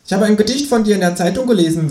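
hoch gesetzte, freche Känguru-Stimme. Acted. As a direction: das Känguru schnoddrig und höher sprechen. Känguru spricht. (hohe, freche Känguru-Stimme)

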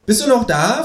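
high kangaroo voice asking; Känguru voice. Acted. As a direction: lift it high, a cartoon animal. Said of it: "fragt das Känguru".

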